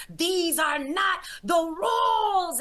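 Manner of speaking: slow, high, loud furious shout; angry demon shout. Retold or imitated. Beelzebub shouting at Jesus for breaking the rules, slow and loud. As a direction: bellow the complaint as a cheated demon. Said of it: "he went to shouting and being angry".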